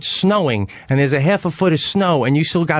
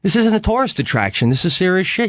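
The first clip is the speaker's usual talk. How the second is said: higher, scolding mock rant at careless hikers; a higher-pitched scold, hammed up lightly; lecturing chalet lady; 6 semitones higher than usual. Chalet lady scolding unprepared hikers, higher mock rant. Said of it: "she's like".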